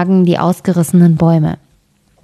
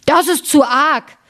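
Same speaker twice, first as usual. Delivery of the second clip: giant's yell; 8 semitones higher than usual. Acted. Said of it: "schrie der".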